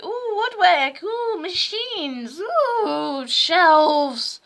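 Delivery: high, loud, hammed-up oohing; mock excitement. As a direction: gush with exaggerated wonder over each item. Acting out excited expectations of tech.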